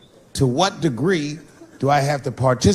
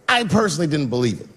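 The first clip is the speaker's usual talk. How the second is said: ordinary talk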